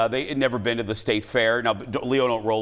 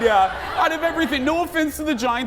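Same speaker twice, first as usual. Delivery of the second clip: laughing retort; 12 semitones higher than usual. Retold or imitated. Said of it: "And I said".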